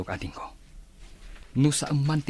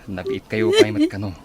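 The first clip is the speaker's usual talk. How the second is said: much higher, laughing, louder mock voice; mimicking Mercy; 6 dB louder than usual. Teasing friend laughing as she mimics Mercy. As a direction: squeal it as if teasing. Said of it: "kuna ni Mercy".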